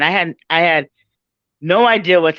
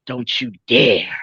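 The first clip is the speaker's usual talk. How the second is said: lower, slow, teasing stern boss; mock stern warning; 6 semitones lower, slower than usual. District leader playfully scolds the modest newcomer, low and slow.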